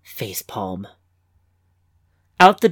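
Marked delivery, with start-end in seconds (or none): 0.0-0.8 low, much softer deadpan mutter; embarrassed muttered aside; muttered facepalm. A narrator's reading. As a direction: mutter it under your breath at yourself. Muttered self-rebuke.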